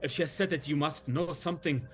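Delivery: a flustered leader jumping at hope; eager Luvash. Acted.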